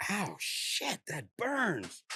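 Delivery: pained yelp. Retold or imitated. Retold getting meth in his eye.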